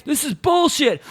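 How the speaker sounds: high-pitched mock heckle; Nick Diaz yelling. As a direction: imitate a fed-up heckler in the crowd. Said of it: "Nick Diaz is yelling this".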